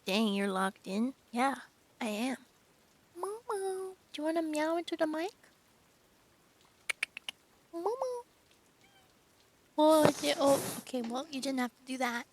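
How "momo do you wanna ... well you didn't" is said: high, sing-song pet voice; talking to the cat. Real, not acted. Streamer coaxing her cat Momo to meow into the mic, high pet voice.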